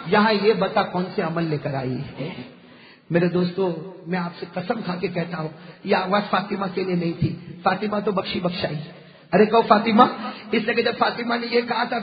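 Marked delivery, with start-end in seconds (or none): 0.1-8.8 much lower and softer, sincere; hushed. Real, not acted. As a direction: drop to a near hush after the climax. Sudden drop after the climax. (دھیمی اور نرم آواز میں)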